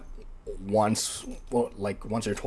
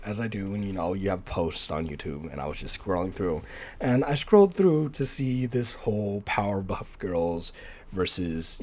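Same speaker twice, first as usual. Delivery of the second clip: ordinary talk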